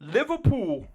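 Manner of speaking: 1 mock Liverpool reply. Tourists answering dad.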